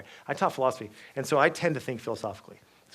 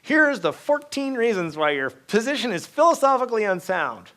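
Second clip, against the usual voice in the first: louder, higher, slow point-by-point lecturing; emphatic argument; 10 semitones higher, 10 dB louder than usual. Retold or imitated. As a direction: hammer home every reason. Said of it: "My tendency is to be like".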